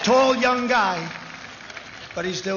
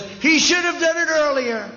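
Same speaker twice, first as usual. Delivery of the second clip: mocking imitation; 8 semitones higher, 4 dB louder than usual. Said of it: "and he said".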